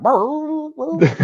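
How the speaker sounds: high revving burr sounds; engine revving noise. Retold or imitated. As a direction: imitate a motorbike revving. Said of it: "I'd be like".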